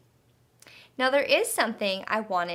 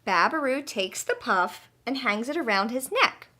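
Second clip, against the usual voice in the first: usual speaking voice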